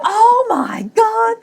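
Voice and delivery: high, slow squeal of disbelief; a giddy shocked laugh; laughing Oh my God; retold laughing gasp. Relief in the elevator.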